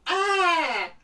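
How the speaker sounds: short high loud hammed-up "Ah!"; mock pained cry. Kit hit in the forearm, hammed-up loud yelp. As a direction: yelp like a hammy TV death. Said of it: "she goes".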